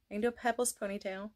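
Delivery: soft, fast cartoon-kid voice; quick little-girl Pebbles voice. Shown as a demonstration. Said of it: "like I said".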